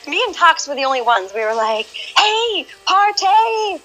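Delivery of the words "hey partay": high, whooping party call; mock party shout, pitched way up; party cheer. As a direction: cheer like the party just started. Partying in global chat.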